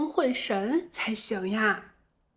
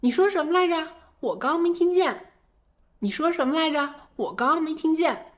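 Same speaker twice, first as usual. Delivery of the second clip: higher asking to repeat; higher demo of asking to repeat in a noisy place; 5 semitones higher than usual. Shown as a demonstration. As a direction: call over the noise, say again. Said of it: "所以".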